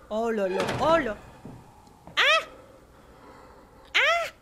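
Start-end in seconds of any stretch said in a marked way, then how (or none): 3.9-4.3 short, high, loud "Ah!"; startled scream. Real, not acted. Player jumping at a scare in the game, high scream. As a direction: shriek at the jump scare.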